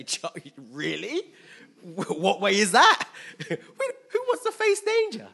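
high, loud, laughing scoff; laughing disbelief. Retold or imitated. Half of us answering the warning, laughing disbelief. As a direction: laugh the idea right off.

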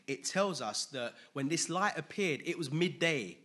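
rising, loud preacher's voice; preaching. Acted. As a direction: preach it out with building fervour. Preaching sermon.

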